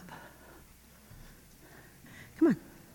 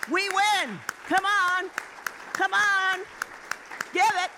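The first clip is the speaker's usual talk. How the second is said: higher, pushing the crowd on; fiery preaching; 6 semitones higher, slower than usual. Acted. Preacher rallying congregation to keep praising. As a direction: urge them to keep going.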